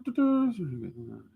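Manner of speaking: usual speaking voice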